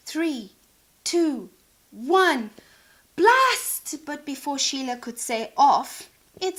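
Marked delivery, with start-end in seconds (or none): 0.1-4.1 countdown rising to a loud "blast"; building countdown. Acted. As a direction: count down louder each number. Countdown to launch.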